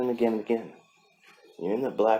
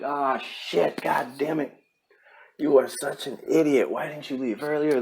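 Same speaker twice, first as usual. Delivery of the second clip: higher, mock-angry inner voice cursing yourself; self-scolding; 5 semitones higher than usual. Scolding himself after missing the bus, mock-angry. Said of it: "I'm like".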